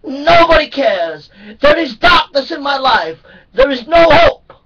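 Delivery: loud, high crying wail of hopelessness; screaming despair. Acted. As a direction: cry out as if nothing is left. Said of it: "screaming in a great megatone voice".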